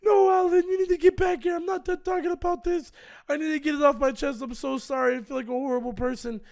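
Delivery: high, loud mock wail; hammed-up crying apology; imitating tearful pleading. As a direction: beg tearfully, exaggerated, easing off toward the end. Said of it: "he's in tears".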